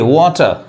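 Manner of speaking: वाटर ending in a schwa, no r; British pronunciation. Shown as a demonstration. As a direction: say वाटर as "waw-tuh". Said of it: "आर ये नहीं पढ़ाएगा British English में".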